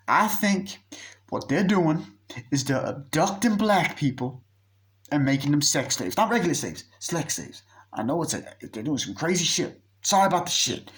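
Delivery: high-pitched, agitated conspiracy pitch; mock earnest theorizing. Friend explaining the abduction theory, high and earnest. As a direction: act out a friend pleading his wild theory.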